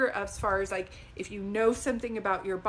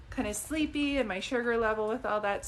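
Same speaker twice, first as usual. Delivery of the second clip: usual speaking voice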